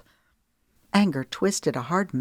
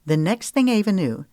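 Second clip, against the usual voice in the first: usual speaking voice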